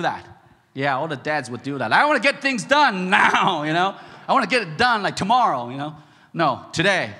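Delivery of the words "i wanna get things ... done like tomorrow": loud, higher, insistent; impatient determination. Acted. Dad insisting the job get finished, loud and impatient. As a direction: demand it done, voice raised.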